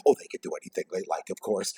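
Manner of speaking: usual speaking voice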